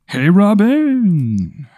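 a faraway voice calling a name in a dream. Retold or imitated.

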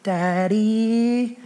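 put-on long wheedling "Daddy" of a child wanting something; drawn-out pleading "Daddy". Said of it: "it's the twiddling of the fingers".

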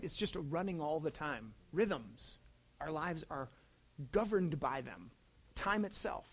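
ordinary delivery

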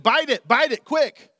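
impatient shouting fisherman; loud urging. Pretend. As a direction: bark it at the fish. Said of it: "and we said".

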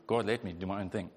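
normal talking voice

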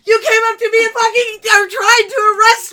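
high, loud furious protest; indignant yelling. Retold or imitated. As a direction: yell it at the officer in outrage. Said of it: "Yeah, I'm yelling".

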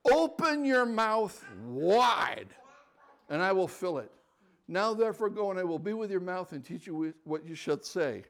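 preacher prophesying. Retold or imitated. Preacher declaring scripture over the shy man, loud. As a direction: proclaim it like a revival preacher.

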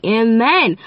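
ordinary delivery

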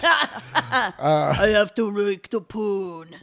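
slow gruff action-star drawl; Vin Diesel voice. Retold or imitated. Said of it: "That's my Vin Diesel impression".

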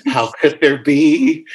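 hammed-up disbelief, pitched high; mock appalled. Guest jokes about finding namesakes in a city, mock appalled. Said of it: "and I'm appalled".

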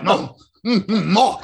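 munch like a delighted blackbird; greedy bird. Acted. Telling co-host about blackbird eating vomit, greedy gobbling. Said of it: "It was like".

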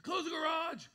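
high, loud insistent order; exasperated command. Retold or imitated. Husband insists to wife, loud exasperated command. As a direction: plead hard, almost shouting. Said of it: "So I'm like".

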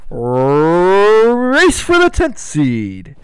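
long drawn-out announcer intro; show-host announcer voice; segment announcer. Pretend. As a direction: roll out the segment title, stretched.

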